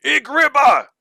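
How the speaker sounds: high, loud battle cry in an alien tongue; sudden loud shout of alarm; warriors shouting. Acted. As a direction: bellow it like a panicked warrior. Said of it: "the Rakas shout".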